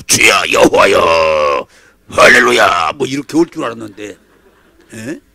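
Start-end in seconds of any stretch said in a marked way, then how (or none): 0.0-2.9 slow, deep, loud hammed-up praise; mock pious welcome. Mocking the prophet's expected welcome, hammed-up pious shout. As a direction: boom it out like a pompous prayer.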